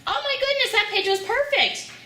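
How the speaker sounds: high, delighted praise; excited praise. Real, not acted. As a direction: gush over the page. Mother delighted at child's reading.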